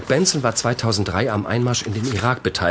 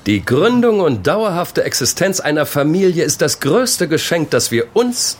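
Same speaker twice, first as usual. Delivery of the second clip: raised, declaimed like a sermon; higher pitch, slowing into weighty phrases; pious lecturing; 4 semitones higher than usual. Acted. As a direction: proclaim it as a solemn creed. Pious lecture on marriage and family.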